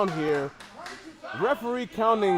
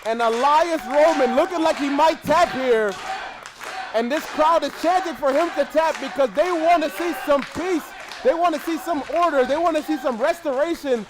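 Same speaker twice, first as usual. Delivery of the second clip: raised, fired-up play-by-play; excited commentary; 6 semitones higher, 4 dB louder than usual. Real, not acted. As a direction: call the hold as the crowd roars. Play-by-play peak, submission hold.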